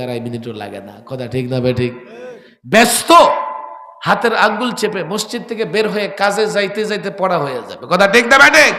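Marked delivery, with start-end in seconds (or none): 2.7-8.7 high, loud fervent preaching; full-force preaching. Real, not acted. Preacher urging the gathering, full-force preaching. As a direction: drive it home to the crowd.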